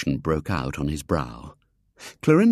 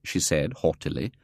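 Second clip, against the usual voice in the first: ordinary delivery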